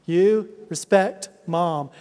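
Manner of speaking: usual speaking voice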